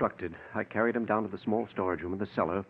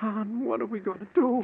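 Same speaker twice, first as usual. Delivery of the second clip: frightened; 12 semitones higher than usual. Acted. Frightened brother.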